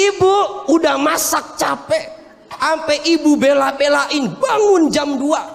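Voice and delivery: high, wounded soap-opera lament; soap opera wife. Acted. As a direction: play the hurt wife, hammy. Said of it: "Kayak sinetron".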